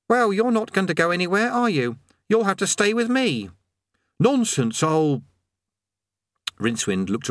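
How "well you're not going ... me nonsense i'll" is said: put-on high young boy's voice; lightly high-pitched youth voice; boy Eric.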